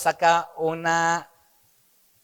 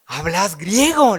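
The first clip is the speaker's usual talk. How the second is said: surprised question; 3 semitones higher, 5 dB louder than usual. Acted. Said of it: "le dice".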